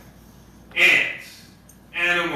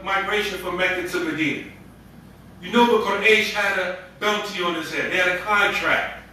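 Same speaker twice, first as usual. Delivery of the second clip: ordinary talk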